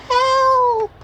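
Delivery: very high squealing help. Acted. The nut to the animals, squealing for help. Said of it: "squealed the nut".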